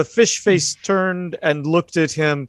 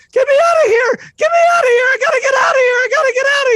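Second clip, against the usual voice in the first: high, loud, frantic yelling to be let out; panicked shout; 6 dB louder, much faster than usual. Acted. Bubba bangs on the door and yells to the group in panic. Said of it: "you didn't include the, uh, southern drawl".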